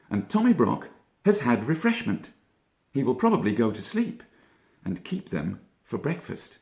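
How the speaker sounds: high, quick puzzled rabbit; Peter Rabbit voice. A narrator's reading. Peter asking his cousin, puzzled high rabbit voice. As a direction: lift the voice into a curious young rabbit.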